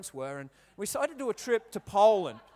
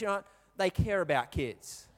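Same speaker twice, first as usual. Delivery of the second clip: normal talking voice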